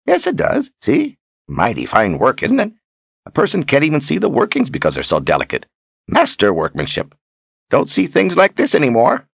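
high, chatty, admiring character voice; Zed, proudly showing off. Acted. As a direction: show off a treasure with relish.

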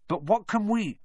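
high, startled, broken-off question; gasped. A narrator's reading. Hermione spots Snape mid-question, gasping. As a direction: break off as if startled. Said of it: "Hermione gasped".

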